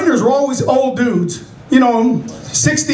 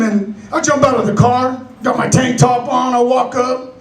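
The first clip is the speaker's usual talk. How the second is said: usual speaking voice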